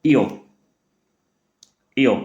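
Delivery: इयो, a rounded ö vowel; sound of ö. Shown as a demonstration. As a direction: say इयो for o-umlaut. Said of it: "उसको क्या पढ़ेंगे हम लोग".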